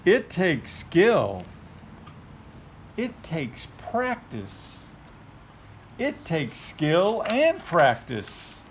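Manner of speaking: slow reading. Acted. Reading slowly to toddler.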